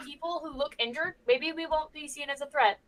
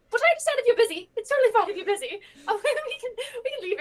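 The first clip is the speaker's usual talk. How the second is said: higher, faster, flustered apology; nervous rush; 7 semitones higher, faster than usual. Acted. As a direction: babble nervously with a laugh. Nervously backing off at door.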